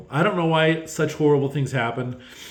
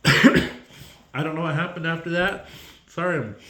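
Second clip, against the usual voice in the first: crying; 4 semitones higher than usual. Real, not acted. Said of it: "I'm, like, crying and not prepared".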